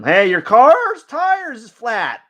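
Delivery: slow, high, loud call across the street; pretend yell. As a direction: shout it to a passing driver. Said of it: "maybe if I shout out and yell to him".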